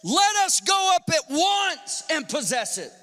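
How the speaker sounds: rally them with a shout; eager Caleb. Acted. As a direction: bold Caleb urging the people. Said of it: "Caleb, saying".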